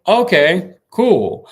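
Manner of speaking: high, loud mock okay; sarcastic surprise. Real, not acted. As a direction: act cool but stunned. Reacting to her claim.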